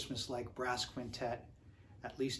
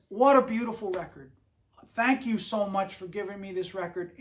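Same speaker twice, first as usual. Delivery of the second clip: put-on loud, higher Pittsburgh accent, gushing; a Pittsburgh old-timer gushing thanks, pitched up and loud; 3 semitones higher, 8 dB louder than usual. Old arranger thanking the trumpeter for the record, in a loud Pittsburgh accent. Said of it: "He talks like this 'cause he's from Pittsburgh".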